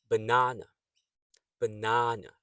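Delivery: "Banana" as buh-NAH-nuh; stress on second syllable. Shown as a demonstration. Teacher gives practice word, "Banana" stressed on second syllable. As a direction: punch the middle "na" of "Banana", schwa either side. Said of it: "the second sound is stressed".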